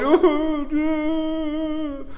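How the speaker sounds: high, drawn-out mock wail; hammed-up sobbing howl, held long; mock crying. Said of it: "I just started crying".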